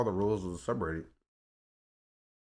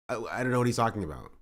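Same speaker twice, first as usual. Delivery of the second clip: ordinary talk, nothing marked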